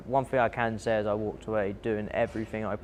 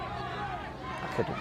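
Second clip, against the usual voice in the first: breathless, voiceless admission; exhausted whisper; 9 dB quieter than usual. Real, not acted. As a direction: let it fall out as a spent whisper. Admitting exhaustion after first round.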